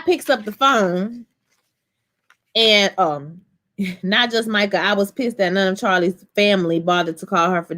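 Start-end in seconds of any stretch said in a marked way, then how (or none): none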